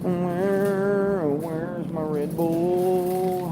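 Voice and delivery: long, held vowels, softly sung out; mock searching call, stretched very slowly; drawn-out calling. Shopper hunting for missing Red Bull, drawn-out call.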